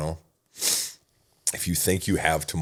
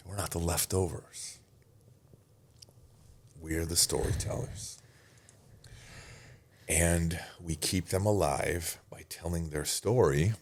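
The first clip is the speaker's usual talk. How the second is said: slow sincere comfort; 6 dB quieter than usual. Retold or imitated. Consoling friend at funeral.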